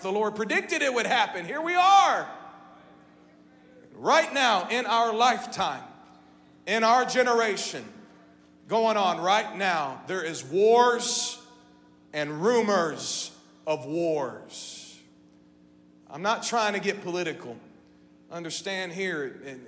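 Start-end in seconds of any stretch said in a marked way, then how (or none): 0.0-2.3 voicing his own amazement. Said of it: "and I'm like". 3.9-15.0 fervent preaching. Acted. Preacher stirring the church about wars now, full-force preaching.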